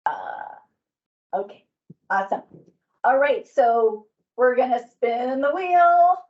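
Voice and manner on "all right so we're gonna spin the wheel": hyped giveaway announcement. Real, not acted. Announcing giveaway winner on call.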